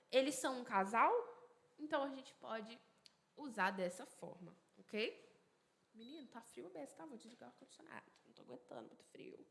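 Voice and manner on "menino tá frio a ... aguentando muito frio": very soft, quick off-topic aside; hushed aside. Real, not acted. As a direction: drop right down and hurry through a side complaint. Aside about the cold room. (comentário à parte, baixinho e rápido)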